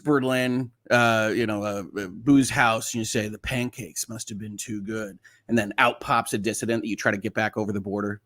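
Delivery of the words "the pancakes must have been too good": a softer, hushed secret code phrase; lightly played Cold War spy, soft and secretive; mock spy password. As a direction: murmur it like a spy giving the password in a bar. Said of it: "some kind of secret spy code".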